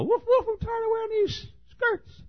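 very high squeaky complaining dog; tiny high yappy voice; female Chihuahua voice. Acted. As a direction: gripe like a pampered lapdog. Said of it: "There's a female Chihuahua".

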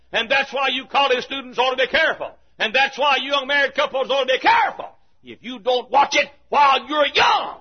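fiery pulpit exhortation, building; preaching. Acted. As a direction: warn the congregation with rising force. Preaching at full force.

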